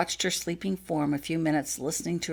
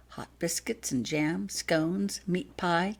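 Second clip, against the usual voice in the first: ordinary delivery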